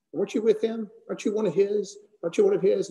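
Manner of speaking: high, prodding accusations of the bystanders; accusing questioners. Retold or imitated. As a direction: needle him with high, pointed questions. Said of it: "he's asked three times".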